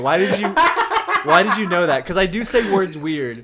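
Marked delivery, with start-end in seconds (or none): 0.0-2.1 high, loud laughing questions; laughing disbelief. Real, not acted. Man laughs at friends predicting his odd word. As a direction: laugh through the accusing question. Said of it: "laughing disbelief".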